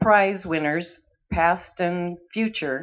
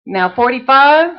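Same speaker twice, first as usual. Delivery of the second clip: rapid rhythmic bid chant; auctioneer; 5 semitones higher, 6 dB louder than usual. Acted. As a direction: rattle off bids like an auctioneer.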